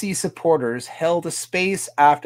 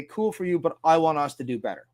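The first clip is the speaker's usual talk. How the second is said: ordinary talk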